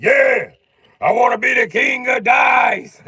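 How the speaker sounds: slow, high, shouted demand; shouted wish. Retold or imitated. Sam shouting his wish to the devil, slow high shout. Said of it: "He was like".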